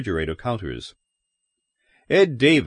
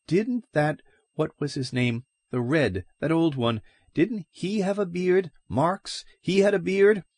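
much higher, nosy excited busybody; suspicious gossip; 8 semitones higher than usual. A narrator's reading. Gossip to grocer about Marx, high eager voice. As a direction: piece it together, eagerly suspicious.

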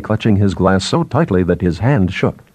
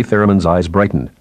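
plain reading voice

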